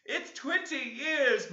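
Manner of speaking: put-on high, slow, grieving child's voice; mourning ghost. Ghost child mourning at the window, high slow lament. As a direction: lament the long exile. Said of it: "mourned the voice".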